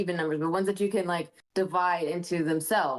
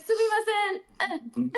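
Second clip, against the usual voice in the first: very slow, high laughing sumimasen; laughing mock Japanese; 12 semitones higher, much slower than usual. Lost American tourist asking for help, laughing high. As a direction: squeal sumimasen through laughter. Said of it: "I would be like".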